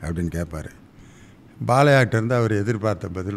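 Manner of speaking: ordinary delivery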